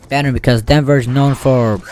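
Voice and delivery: normal talking voice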